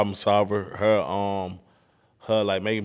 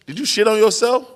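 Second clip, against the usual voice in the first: higher, louder accusing question; 11 semitones higher, 7 dB louder than usual. Retold or imitated. Dad confronting his toddler over a smell, loud and high. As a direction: demand it, pitched way up.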